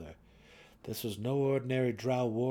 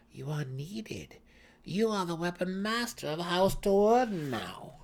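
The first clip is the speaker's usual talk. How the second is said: coaxing priestess; 8 semitones higher than usual. A narrator's reading. Brisa tempts Drizzt with a title, coaxing. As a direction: lure him with honours.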